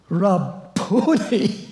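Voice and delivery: high, slow, drawn-out Rabboni; joyful cry of recognition. Shown as a demonstration. Mary recognizing Jesus, crying out with joy. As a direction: Rabboni sung out high and long with sudden joy. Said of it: "my teacher, she calls him".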